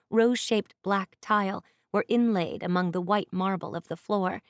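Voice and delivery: usual speaking voice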